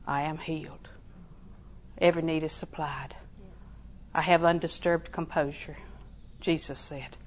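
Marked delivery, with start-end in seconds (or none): 0.1-0.9 breathy whisper of faith; soft whispered confession; whispered affirmation. Real, not acted. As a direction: whisper it as a secret certainty. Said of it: "So you're gonna die".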